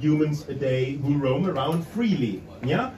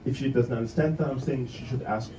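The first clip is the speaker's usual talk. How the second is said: ordinary talk, nothing marked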